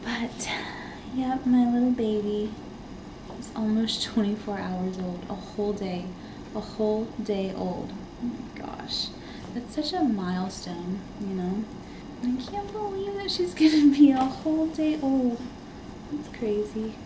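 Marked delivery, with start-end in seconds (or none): none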